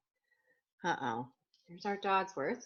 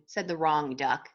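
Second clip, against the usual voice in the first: usual speaking voice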